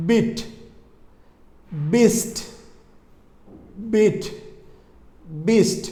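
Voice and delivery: "বিট" and "বিস্ট" with a firmly released b; each word isolated, long pauses between; closed-lip B words. Shown as a demonstration. Teacher drills B words, deliberate and separated. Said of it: "দুই ঠোঁট বন্ধ করে উচ্চারণ করুন".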